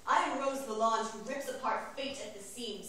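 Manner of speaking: ordinary talk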